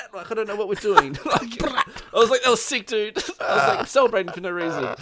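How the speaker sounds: high, loud, giggly retelling; laughing. Real, not acted. Gamer laughing at celebrating for no reason, laughing through the words. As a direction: crack up while telling it.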